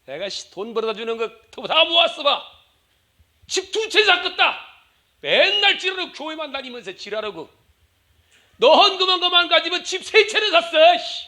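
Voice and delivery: hammed-up griping at the wife; husband berating churchgoing wife; mock angry husband. Husband nags wife over tithes.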